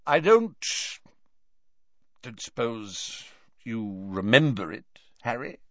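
hesitant, halting question; awkward. Acted. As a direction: ask it awkwardly, unsure. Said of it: "he asked awkwardly".

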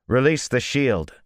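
loud, high order; loud confident command. A narrator's reading. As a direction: expect to be obeyed. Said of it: "as if he expected obedience".

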